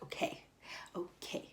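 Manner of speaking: barely voiced, breathy okay okay; hushed okay. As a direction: say it almost under the breath, giving in. Resigned reply to Edwin.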